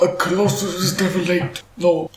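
mock-wise wizard pronouncement; Gandalf. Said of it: "the Gandalf voice".

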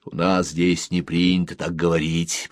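usual speaking voice

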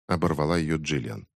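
plain reading voice

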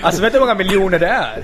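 loud, high, laughing disbelief; laughing incredulously. Real, not acted. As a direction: exclaim it through real laughter. Amazed and laughing at the apartment's value.

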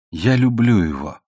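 unforced, understated declaration; said simply. A narrator's reading. Young woman confesses her love to Sir John, simply. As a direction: state it simply, without display. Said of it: "просто сказала она".

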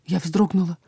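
usual speaking voice

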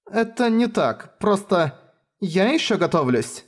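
halting, struggling for words; stalling, unsure how to answer; hesitant. Acted. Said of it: "Ей никак не давались слова".

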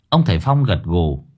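usual speaking voice

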